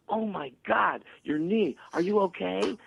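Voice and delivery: panicked parent. Retold or imitated. Panicking parent over child's scraped knee, mock frantic. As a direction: gasp it out like a hammed-up frantic parent. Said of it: "and you go".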